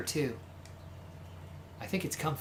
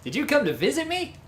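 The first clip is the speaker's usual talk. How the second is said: higher, playful rogue; flamboyant charmer voice; 5 semitones higher than usual. Acted. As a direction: greet them like a dashing flirt. Voices Yuya, high cheerful rogue.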